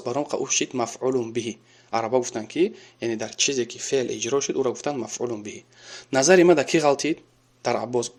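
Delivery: ordinary talk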